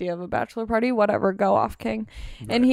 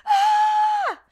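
reenacted startled shriek, held long and high; high-pitched scream. Woman retelling her childhood shock at the tape, high scream. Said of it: "So I just screamed".

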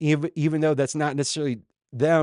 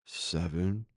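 lower, softer, sheepish answer; tail between legs; 6 semitones lower, 7 dB quieter than usual. Acted. Disciples answering Jesus a second time, tail between their legs. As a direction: give it up reluctantly and small. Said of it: "answering with tail between their legs".

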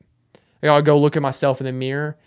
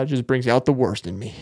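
usual speaking voice

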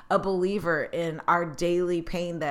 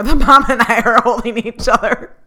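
laughing; 4 semitones higher, 13 dB louder than usual. Real, not acted. Retelling emotional reunion hug.

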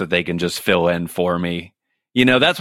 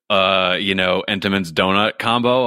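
normal talking voice

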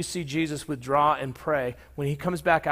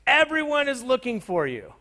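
exclaiming; 9 semitones higher, 4 dB louder than usual. Acted. Disciples finding Jesus at prayer, exclaiming. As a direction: call it out high to someone you've been searching for. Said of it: "they exclaimed".

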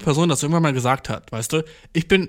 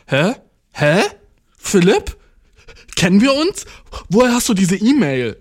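raised, louder baffled questions; mock bewilderment; 4 semitones higher, 4 dB louder than usual. Host mock-answering a spam mail from Philipp, bewildered. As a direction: play baffled, pitch climbing on each question.

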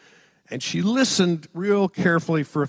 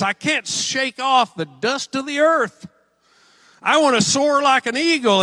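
higher, louder pleading to God; impassioned lament; 7 semitones higher, 4 dB louder than usual. Retold or imitated. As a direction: cry out like a psalmist, yearning. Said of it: "The psalmist is crying out".

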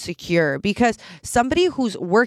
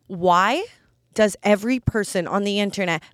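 normal talking voice

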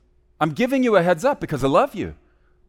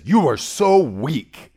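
slow, cold, belittling voice of the enemy; harsh accuser; much slower than usual. Retold or imitated. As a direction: sneer it out slowly, like a tormentor. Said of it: "talking like this".

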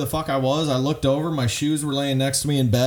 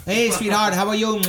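catcalling; 7 semitones higher than usual. Retold or imitated. Imitating man catcalling women.

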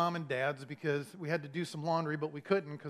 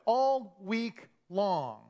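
very slow, raised "All week long"; drawn-out emphasis; 4 semitones higher, 4 dB louder, much slower than usual. Shown as a demonstration. Dwelling on the cats' misdeed.

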